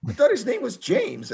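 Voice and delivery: high incredulous record men; puzzled producers. Retold or imitated. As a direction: pitch it way up, baffled. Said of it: "they're like".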